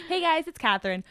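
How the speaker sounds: bubbly influencer outro voice; chirpy vlogger sign-off. Pretend. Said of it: "using my influencer voice".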